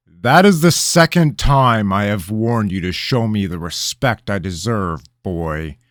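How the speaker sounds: loud, menacing royal warning; king threatening the kneeling boy, menacing; dangerous. A narrator's reading. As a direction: lean on every word like a threat. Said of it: "the king said dangerously".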